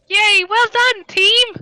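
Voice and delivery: high, loud cheerleader voice; high over-the-top mock praise; mock cheer. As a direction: cheer the team on from the back. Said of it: "Paige in the background just going".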